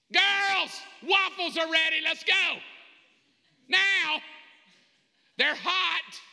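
put-on high, loud shout to the kids; parent's yelled summons. Father calling daughters to breakfast, shouted order. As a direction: holler like an impatient parent. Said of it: "This is how it goes in my house".

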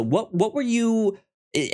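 ordinary talk

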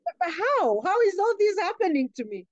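reeling, asking how this can be happening; shocked confusion. Retold or imitated.

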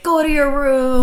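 high, loud parental order; hammed-up shouted command; mock exasperated yell. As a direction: act out sending a kid off at the end of your rope. Mock exasperated parent, versus mantras.

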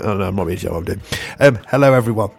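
usual speaking voice

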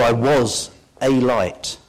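"was" slowed and stressed. Shown as a demonstration. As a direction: lean on "was", slowly.

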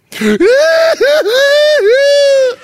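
high loud hammed-up wail; exaggerated mock weeping; mock wailing sob. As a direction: wail like she's devastated. Mimicking weeping bride.

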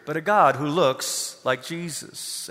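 ordinary talk

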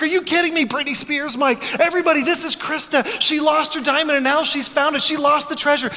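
high, loud mock announcement; high excited instructor voice. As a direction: announce it like a thrilled fitness instructor. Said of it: "The instructor's like".

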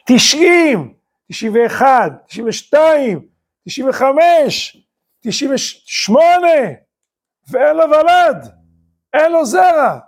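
suddenly high, each year drawn out and falling; storyteller piling up the years toward a lament; mounting dramatic count. Acted. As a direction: pitch up high, stretch the last numbers. Years mount, still no promised child.

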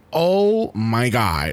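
low, drawn-out gasp of awe; awestruck reaction. Retold or imitated. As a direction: stretch it out slowly, dropping low in amazement. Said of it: "es como que".

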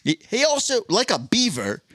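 high-pitched Australian wildlife presenter; Steve Irwin. Acted. Wildlife host likening a man to a beaver, high Australian impression. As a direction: introduce animal habits in an Australian accent. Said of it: "I don't think I can do the Australian accent".

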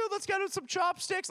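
high, mocking teasing voice; teasing friends. Friends teasing him about China, high mocking voice. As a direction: needle him like a classmate poking fun. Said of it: "They're like".

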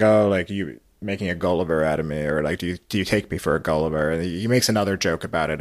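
usual speaking voice

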